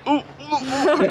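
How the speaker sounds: high squeaky ooh. Retold or imitated. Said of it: "I was like".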